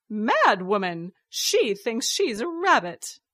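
loud, mocking dismissal; scornful accusation. A narrator's reading. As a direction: throw it out with open scorn. Villain derides her accuser aloud.

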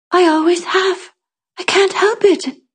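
put-on very high, small child voice; child's confession. A narrator's reading. Child explaining the whispering to the Tanner, high small voice. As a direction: pitch it way up, explaining helplessly. Child answers in hushed exchange.

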